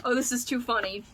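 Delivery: ordinary talk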